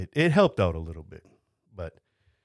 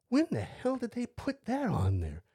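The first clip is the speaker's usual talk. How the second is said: high, slow, incredulous outcry; stunned disbelief, pitched way up; mock shock; 13 semitones higher, 5 dB quieter, slower than usual. Discovering fall risk wristband.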